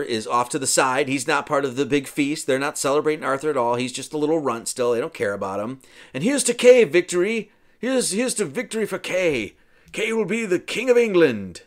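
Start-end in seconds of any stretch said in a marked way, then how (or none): 6.1-11.6 high, loud acted cheer; hearty toast. Feast guest toasting Kay as future king, hearty proclamation.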